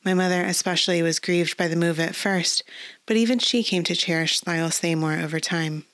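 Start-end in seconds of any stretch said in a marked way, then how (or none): none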